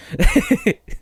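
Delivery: high squealing laugh; burst of laughter. Real, not acted. Laughing at own joke about not being yourself, high squeal of laughter. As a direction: crack up at your own joke.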